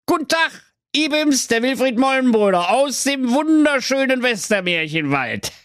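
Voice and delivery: high, put-on sly wizard voice; evil wizard voice. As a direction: play the smarmy villain greeting them. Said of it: "böse Zauberer vor ihn und sprach".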